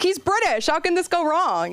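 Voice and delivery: high, chirpy and hopeful; mock naive optimism. Comic recalling her hopes for the Uber driver, high chirpy optimism. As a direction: sell it like a sure thing. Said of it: "I was like".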